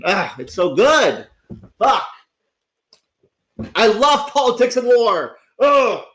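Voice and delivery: loud, high gush of delight; excited outburst. Real, not acted. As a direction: burst out with real glee about the game. Gushing about loving the game.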